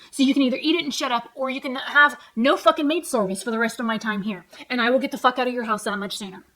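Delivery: loud, heated retold tirade; worked-up and insistent, re-enacting a row; angry ultimatum. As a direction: lay down terms in a loud rant. Retells her angry ultimatum to dad.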